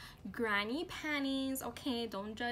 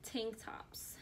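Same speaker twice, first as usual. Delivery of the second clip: whisper; 8 dB quieter than usual. Real, not acted. Whispered product name aside.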